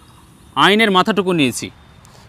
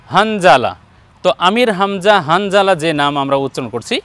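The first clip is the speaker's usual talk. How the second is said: ordinary delivery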